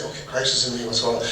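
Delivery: softly, inward and hushed; a quiet prayerful aside; quiet reflection. Retold or imitated. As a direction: speak it gently to yourself. Quiet prayerful inner talk.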